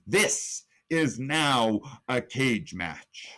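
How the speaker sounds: ordinary talk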